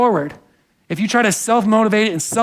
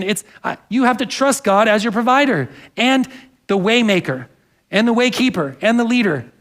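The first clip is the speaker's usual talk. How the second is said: ordinary talk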